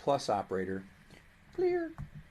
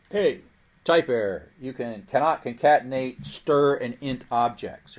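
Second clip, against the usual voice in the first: loud, hammed-up telling-off; mock scolding; 3 semitones higher, 10 dB louder than usual. Teacher voicing Python scolding a bad programmer, loud mock scolding. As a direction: scold the student like a cross computer. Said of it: "I'm scolding you".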